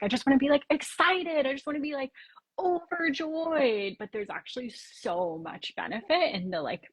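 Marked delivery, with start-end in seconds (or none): 0.0-3.9 high, eager, wishful gush; gushing excitement. Retold or imitated. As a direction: dream of being overjoyed, up high. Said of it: "people I work with are like".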